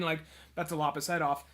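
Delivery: normal talking voice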